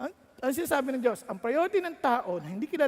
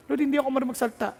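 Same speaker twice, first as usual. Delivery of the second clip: anxious plea; 2 semitones higher, 3 dB louder, much faster than usual. Acted. Young pastor quickly pleading excuses to God. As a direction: rush the excuse out.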